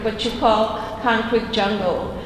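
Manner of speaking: normal talking voice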